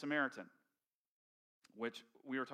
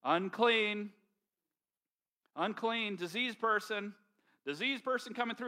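loud warning cry from a distance; mock leper shouting a warning to the crowd; yelling out; 7 semitones higher, 9 dB louder than usual. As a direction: call it out loud to keep people away. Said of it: "They had to yell out".

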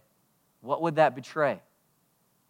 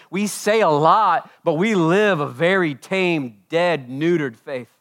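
emphatic preaching; 4 semitones higher, 9 dB louder, slower than usual. Acted.

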